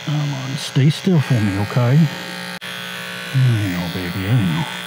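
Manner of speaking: soft soothing pet talk; calm the dog while grooming. Real, not acted.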